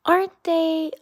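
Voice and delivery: a timid little Mole, mildly anxious; nervous Mole. Acted. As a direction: Mole asking with a touch of worry. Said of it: "with a trifle nervously".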